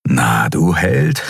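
slow, low, sneering mock; malicious inner voice. A narrator's reading. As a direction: taunt him slowly from inside his head. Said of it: "sagte eine bösartige Stimme". (langsame, tiefe, höhnische böse Stimme)

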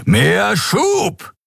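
high, ringing captain's command, punching "Schub"; commanding order. A narrator's reading. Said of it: "befahl Kapitän Sron".

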